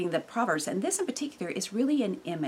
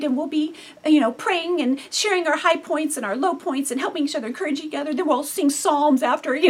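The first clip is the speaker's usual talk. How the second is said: dreamy idealising; 6 semitones higher, 4 dB louder than usual. Retold or imitated. Idealised family dream, mock.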